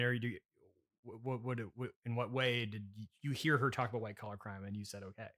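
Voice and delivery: usual speaking voice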